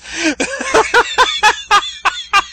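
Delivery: helpless high laughter; can't stop laughing; laughing uncontrollably at the clip; real laughter. Real, not acted. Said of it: "I can't help but actually laugh uncontrollably".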